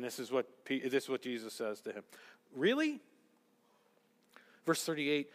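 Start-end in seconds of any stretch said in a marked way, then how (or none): 2.5-3.0 doubting really. Acted. Jesus' doubtful reply voiced.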